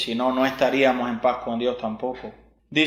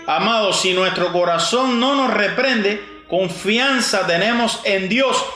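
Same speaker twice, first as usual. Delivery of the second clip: higher and louder, driving the verse home; emphatic repeat; 5 semitones higher, 3 dB louder than usual. Shown as a demonstration. Preacher repeating the verse to his congregation, louder and higher. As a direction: preach it again with more fire. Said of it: "Fíjate, te lo voy a repetir".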